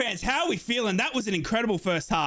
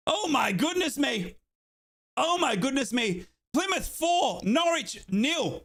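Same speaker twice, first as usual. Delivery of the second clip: astonished; 5 semitones higher, slower than usual. Real, not acted. Astonished at the half-time score.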